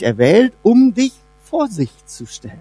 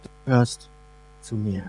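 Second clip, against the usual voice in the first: much softer, almost breathed, like a secret promise; hushed and tender; 12 semitones lower, 11 dB quieter than usual. Acted. God speaking lovingly to the believer, hushed and tender. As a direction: lower and hush it, du gehörst zu mir as a loving whisper.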